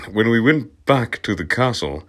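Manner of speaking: usual speaking voice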